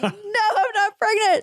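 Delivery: very high, laughing retold protest; amused, high-pitched reenacted denial. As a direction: squeal the denial through laughter. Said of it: "I just laughed".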